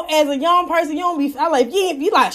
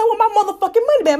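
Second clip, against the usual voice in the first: demanding money back; 7 semitones higher, faster than usual. Retold or imitated. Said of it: "I said".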